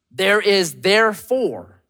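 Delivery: slow, deliberate verse reading; scripture proclamation. Real, not acted. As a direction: declare each word of scripture with weight. Proclaims a Bible verse slowly.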